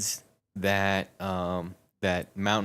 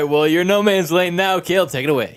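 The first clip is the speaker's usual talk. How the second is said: normal talking voice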